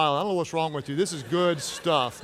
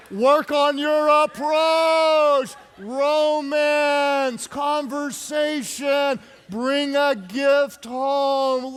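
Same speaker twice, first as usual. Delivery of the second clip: slow, stretched, raised preacher's cadence; drawn-out preaching; 6 semitones higher, much slower than usual. Real, not acted. Preacher urges husbands to be romantic, drawn-out preaching. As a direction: proclaim each item, stretching the words out.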